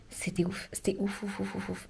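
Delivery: hushed, breathy repeated ouf; awed whisper. Real, not acted. Vlogger raves about her first musical in an awed whisper. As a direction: whisper it like you're still amazed.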